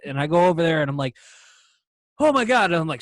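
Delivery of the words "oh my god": retold starstruck outburst, pitched high and loud; starstruck gasping exclamation. Musician retelling spotting his idol at a bar, starstruck. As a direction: exclaim it like an overexcited fan.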